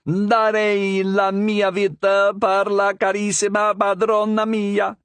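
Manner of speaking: put-on Italian accent parrot. Parrot pledging its life in Italian, high Italian accent. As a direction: sing it out slowly in Italian accent. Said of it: "in an Italian accent".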